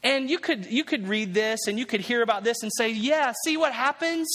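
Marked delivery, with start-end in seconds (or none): 2.9-4.3 higher, drawn-out gloating "see?"; mock know-it-all. Preacher voicing a doubter who says see what happens, mocking. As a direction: voice a smug doubter, pitched up. Mocking onlooker voiced in sermon.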